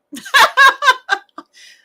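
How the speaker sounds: high, loud cackling burst; real laugh at yourself; self-deprecating laugh. Real, not acted. Laughing at own confession.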